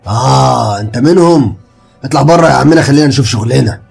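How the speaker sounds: loud, irritated dismissal; gruff brush-off. Acted. Gravedigger sending Ali out of the grave, irritated. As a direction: shoo him out impatiently.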